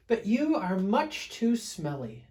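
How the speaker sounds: a touch of a kind bear teasing; a voice from above. Acted. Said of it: "said a voice from above".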